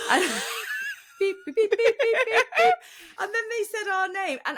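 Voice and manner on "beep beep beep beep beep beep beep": high, loud laughing beep beep over the swear; laughing mock bleeps. Telling the win story, she laughs and bleeps herself out. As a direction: bleep yourself out, giggling.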